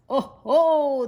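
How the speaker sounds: called out. Acted. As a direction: crow over the trapped boy. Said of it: "the king of sharks called".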